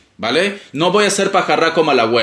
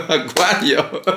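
riendo; much slower than usual. Real, not acted.

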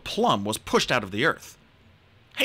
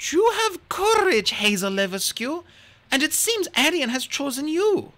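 a higher, approving royal voice; a queen bestowing honour; the queen declaring Arion has chosen Hazel; regal queen; 13 semitones higher than usual. Acted.

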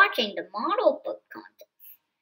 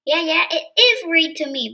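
plain reading voice

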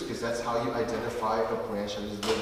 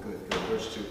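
ordinary talk

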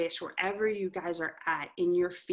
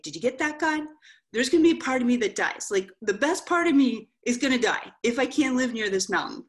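raised, louder plea flung at God, pitched higher; crying out to God; 6 semitones higher, 5 dB louder than usual. Retold or imitated. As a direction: demand that God hear you, voice up and pushing.

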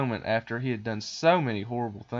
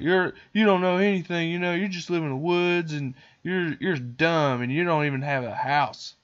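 higher-pitched bratty put-down; hammed-up childish scorn; mock scornful boy; 6 semitones higher, 4 dB louder than usual. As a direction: imitate a kid lashing out. Boy insulting the animals.

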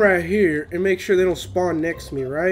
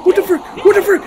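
high loud cry of shock; startled shout; 8 semitones higher, 6 dB louder than usual. Real, not acted. Gamer spotting a strange monster, startled shout. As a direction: jump out of your skin and yell.

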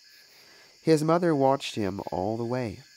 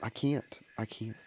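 softer, fading little sobs; small boy sobbing, going quiet; sobbing child; 7 dB quieter than usual. Acted. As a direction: let the refusal die away in mild sobs. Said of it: "he repeated sobs of".

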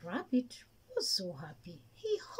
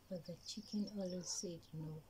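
plain reading voice

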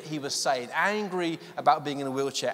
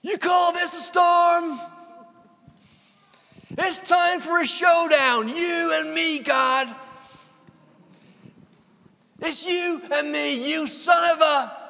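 high, loud, raging shout at the heavens; shouted lament at God; 13 semitones higher, 6 dB louder than usual. Acted. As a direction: roar a challenge up at God in full fury. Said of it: "He shouts and laments at God".

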